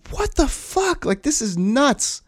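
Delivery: can't believe what he just heard; stunned disbelief. Retold or imitated. Reacting to date's roofie story.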